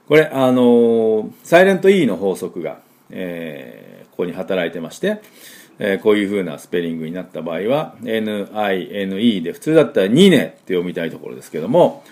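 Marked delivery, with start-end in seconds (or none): none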